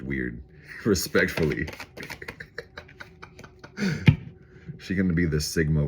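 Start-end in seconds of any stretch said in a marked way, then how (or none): 1.7-4.3 breathy laugh. Real, not acted. Laughing at own joke.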